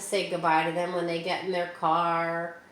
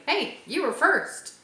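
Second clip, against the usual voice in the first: bright, higher greeting voice for a party host; cheery greeting; 9 semitones higher, 4 dB louder than usual. Pretend. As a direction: greet the early guest brightly, pitched up. Greeting the early party guest.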